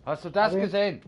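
usual speaking voice

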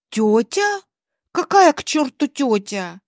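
incredulous questioning echo, then mild outrage; acted indignant questioning; indignant disbelief. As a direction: throw the word back in disbelief. Alina outraged at stranger's claim.

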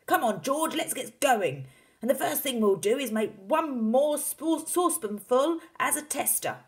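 loud, high, eager urging; rally your son with gusto; Mister Cranky, excited. A narrator's reading. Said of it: "cried Mister Cranky".

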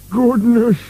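high-pitched cry of surprise; startled exclamation. A narrator's reading. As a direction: exclaim in alarm, voice jumping up. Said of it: "exclaimed Boney".

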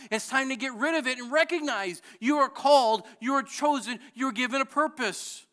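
loud, high rising sermon voice; impassioned pulpit preaching; fervent preaching. Acted. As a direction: preach it with growing fire.

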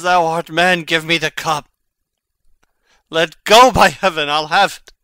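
wrench the cup away and shout; struggling shout. Acted. Hamlet wrestling the cup from Horatio, shouting.

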